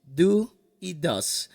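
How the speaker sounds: teacher marking the key words; emphasized auxiliaries. Shown as a demonstration. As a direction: stress do and does. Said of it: "los auxiliares del presente simple".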